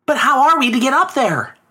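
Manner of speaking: put-on very high, childlike question; storybook son, high voice; young boy's voice. A narrator's reading. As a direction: ask as a puzzled boy, pitched right up. Boy questions father's impossible plan.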